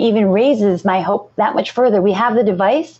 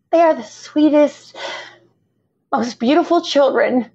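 talking through tears, voice higher and strained; real crying; 5 semitones higher than usual. Real, not acted. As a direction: push the words out while crying. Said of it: "I'm crying and I'm sorry".

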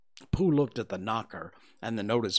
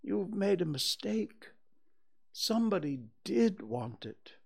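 measured, grave correction with pauses; solemn; 7 semitones higher than usual. A narrator's reading. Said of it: "said Pooh solemnly".

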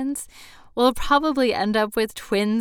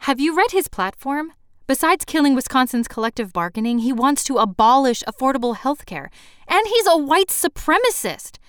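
higher, louder, indignant challenge; heated argument; 6 semitones higher, 8 dB louder than usual. Acted. Arguing with the host over a politician, heated. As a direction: build an indignant case, point by point.

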